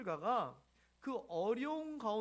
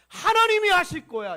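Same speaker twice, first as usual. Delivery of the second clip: rising louder and higher to a shout; building declaration; 8 semitones higher, 18 dB louder than usual. Acted. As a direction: Moses declaring to himself that God will act. Moses repeating, building to a shout.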